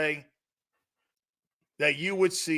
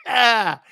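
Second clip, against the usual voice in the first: mock groan; 4 semitones higher, 7 dB louder than usual. Mock disgust shout.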